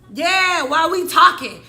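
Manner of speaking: loud, high-pitched agitated challenge; re-enacted fired-up group outcry; riled-up friends. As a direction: chime in loudly, ready for action.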